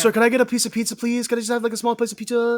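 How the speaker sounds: high, timid little ask; meek tourist. Pretend. Tourist meekly ordering pizza in New York, high and timid. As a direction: plead small like a shy tourist.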